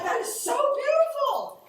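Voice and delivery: high, breaking, choked-up gush; moved gush. Retold or imitated. Telling the audience how she cried at the foster care talk, squeaky moved voice. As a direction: squeak it out, overwhelmed and teary. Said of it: "'cause I'm like".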